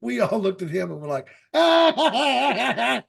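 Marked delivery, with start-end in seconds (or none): 1.5-3.0 high, loud burst of laughter; imitated crowd laughter. Whole crowd laughing at the kid, imitated guffaw. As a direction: burst out like the onlookers. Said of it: "and started laughing".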